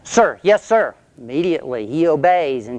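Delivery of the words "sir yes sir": high, loud snappy sir yes sir; recruit's reply. Retold or imitated. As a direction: fire it back like a scared recruit. Recruit answers the drill sergeant.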